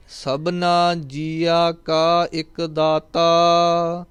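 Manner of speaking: slow, drawn-out devotional chanting of each line; scripture recitation. Acted. Teacher reciting Gurbani for students, slow devotional chant. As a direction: intone the verse slowly and reverently.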